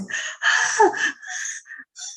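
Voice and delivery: sharp rising gasp; retold gasp. Boy calming down in her arms, a gasp. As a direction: gasp for air. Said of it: "And he was like".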